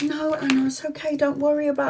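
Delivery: high, very soft imitated friend; soothing reassurance. Friend telling Anna not to worry, soothing. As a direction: soothe them in a small, quiet voice.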